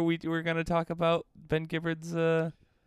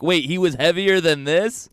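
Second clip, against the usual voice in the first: surprised; 4 semitones higher, 8 dB louder than usual. Retold or imitated. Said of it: "And you're like".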